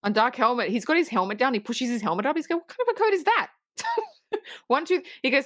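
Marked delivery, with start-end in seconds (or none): 2.6-3.5 high-pitched mock disbelief; incredulous. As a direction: act out a baffled villain, voice jumping up. Retold incredulous Dark Helmet reaction.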